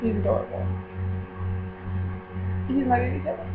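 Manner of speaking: usual speaking voice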